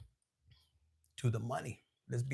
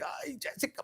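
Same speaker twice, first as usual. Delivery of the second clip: whispered plea; 7 semitones higher, 7 dB quieter than usual. Retold or imitated. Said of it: "And I'm like".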